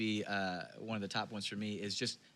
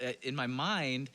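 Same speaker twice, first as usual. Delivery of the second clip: ordinary talk, nothing marked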